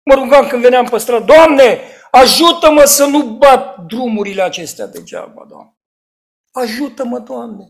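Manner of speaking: loud, high pleading prayer; a preacher's loud retold prayer; fervent preached prayer. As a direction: beg God aloud from the pulpit.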